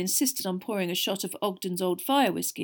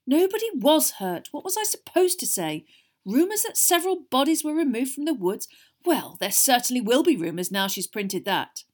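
exasperated; 5 semitones higher, 6 dB louder than usual. A narrator's reading. Arthur exasperated at article.

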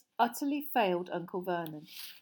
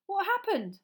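high, urgent questioning; alarmed question; 12 semitones higher, 4 dB louder than usual. Acted. Harry asking his terrified cousin, alarmed. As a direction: ask urgently, pitched high.

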